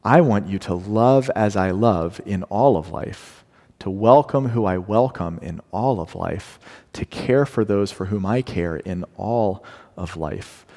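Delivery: slow and soft, sincere; earnest gentle plea. Retold or imitated. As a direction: speak it tenderly. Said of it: "God is saying".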